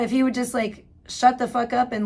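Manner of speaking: ordinary talk, nothing marked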